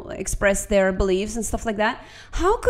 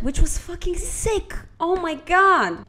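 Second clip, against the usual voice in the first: high-pitched, amazed gush; excited awe; 8 semitones higher than usual. Real, not acted. Vlogger gushing to viewers about parliament, excited awe.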